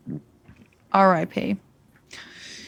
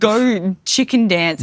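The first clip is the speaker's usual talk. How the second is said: bossy command; 4 dB louder, much slower than usual. Retold or imitated. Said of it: "I'd just be like".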